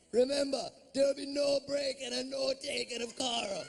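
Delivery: a Jamaican guide laying down the rules; Jamaican tour guide. Retold or imitated. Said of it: "he goes".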